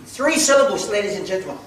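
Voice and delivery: ordinary talk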